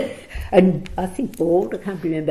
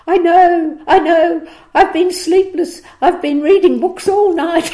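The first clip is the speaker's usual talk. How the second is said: much higher and louder, gushing; giddy drunken email; 11 semitones higher, 8 dB louder than usual. Retold or imitated. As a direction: gush like a sleepless enthusiast. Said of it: "drunken email came back".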